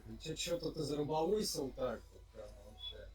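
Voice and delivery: ordinary delivery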